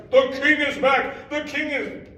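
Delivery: loud joyful shout; knights shouting. Acted. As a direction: cheer from horseback.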